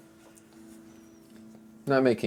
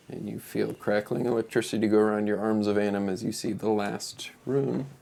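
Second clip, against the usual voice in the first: usual speaking voice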